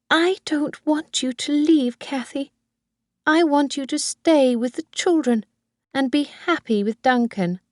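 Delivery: put-on high old woman's voice, clinging and sweet; Missus Harvey, doting old mother. Old mother pleads with Kathy.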